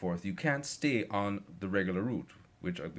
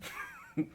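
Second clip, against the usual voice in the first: laugh; 5 dB quieter than usual. Real, not acted. Reaction laugh after the punchline.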